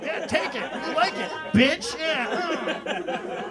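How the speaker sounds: high, slow sleazy taunt while shanking; mock menacing stabber. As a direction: taunt him like a prison thug, high and drawn out. Stand-up acting out the stabbing.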